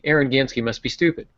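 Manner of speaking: normal talking voice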